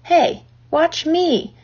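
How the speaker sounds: high, drawn-out show-off call; boy's yell. A narrator's reading. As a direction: call out for everyone to watch. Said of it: "yelled Avery".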